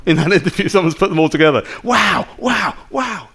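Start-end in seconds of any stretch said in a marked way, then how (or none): 1.8-3.3 a hint of Joe Rogan marvelling at a guest; amazed little wows, dying to a hush; Joe Rogan's wow. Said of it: "all the moments that Joe Rogan said, "Wow!".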